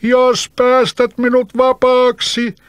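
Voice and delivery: high, loud, slow bargaining cry; bottled spirit calling out to the boy; spirit shouting. Acted. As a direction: plead loudly for freedom. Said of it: "huusi henki".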